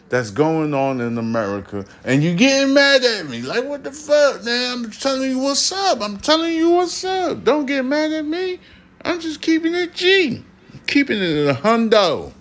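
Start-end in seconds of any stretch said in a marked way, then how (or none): 2.0-12.3 squeaky high mock voice. Pleading not to get mad.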